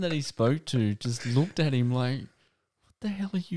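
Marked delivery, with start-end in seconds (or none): 2.8-3.6 mock baffled stranger. Baffled listener quoted.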